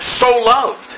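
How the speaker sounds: "so" lifted high and held; stress on SO. Shown as a demonstration. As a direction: hit "so" up high. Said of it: "Emphasizing the word".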